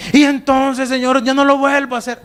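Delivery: high-pitched mock promise; pleading sinner. Sinner promising God to change, exaggerated pleading. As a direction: plead like an insincere penitent.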